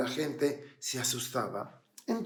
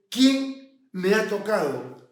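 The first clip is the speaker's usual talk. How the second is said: slow, raised question, pausing after the first word; Jesús; 5 semitones higher, 5 dB louder, slower than usual. Acted. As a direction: stop and ask deliberately, a little louder. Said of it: "y dice". (pregunta pausada y en voz alta)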